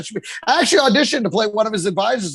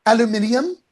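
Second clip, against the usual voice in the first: lightly put-on British secretary; British accent. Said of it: "they have a super hot British".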